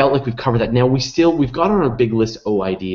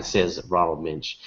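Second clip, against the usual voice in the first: normal talking voice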